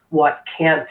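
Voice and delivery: ordinary talk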